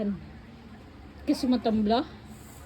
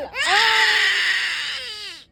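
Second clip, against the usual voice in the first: crying shout; 5 semitones higher than usual. Real, not acted. Child cries out. (sigaw na umiiyak)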